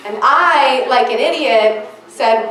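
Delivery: normal talking voice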